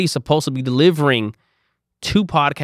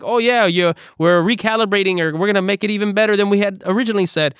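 higher, bright salesy cover story; fake upbeat spin; 6 semitones higher than usual. To waiting brands, covering with a fake upbeat excuse. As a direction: bluff cheerfully to stall them. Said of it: "I'm making up a bunch of B S".

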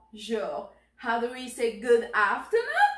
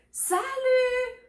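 high, drawn-out hello; cheerful greeting; 12 semitones higher, 3 dB louder than usual. Acted. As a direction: sing out hello to a best friend. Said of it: "when you meet your best friend".

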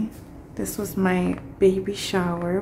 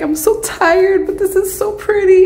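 dramatic whine; 13 semitones higher, 6 dB louder than usual. Retold or imitated. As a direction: play up being worn out but dazzled. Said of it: "I'm being all dramatic".

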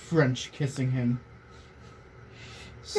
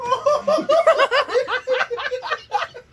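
loud high-pitched laughing fit; wheezing high laugh; 6 dB louder than usual. Real, not acted. Reader cracking up at the fanfic, high laugh. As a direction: burst into helpless laughter.

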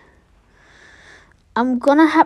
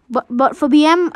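ordinary talk

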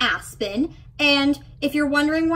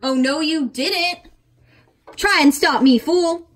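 loud, sassy comeback; defiant diva snapping back; sassy defiance; 3 semitones higher, 8 dB louder than usual. Acted. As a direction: dare them to stop you. Sassy dancer defies the director.

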